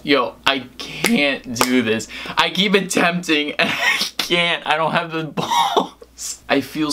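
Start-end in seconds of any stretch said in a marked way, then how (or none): none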